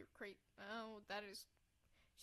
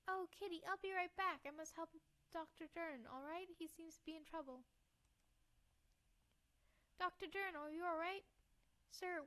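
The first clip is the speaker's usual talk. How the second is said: put-on higher-pitched girl calling out; worried girl; 6 semitones higher than usual. Girl tries to wake an unconscious doctor, worried. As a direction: pitch it up, urgently trying to rouse him.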